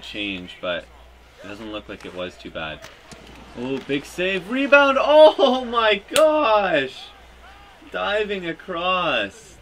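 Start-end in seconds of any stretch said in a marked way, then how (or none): 3.6-9.6 rising, loud play-by-play yell; excited shout. Real, not acted. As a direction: call the save like a hype announcer. Big save during play-by-play.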